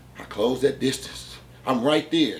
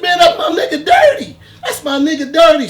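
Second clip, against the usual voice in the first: high, loud, laughing hype-man greeting; loud fake-friendly greeting; 14 semitones higher, 11 dB louder than usual. To the armed man, overjoyed fake-friend shout. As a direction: greet him like a long-lost buddy, way up high. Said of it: "So the whole time I'm jeffing".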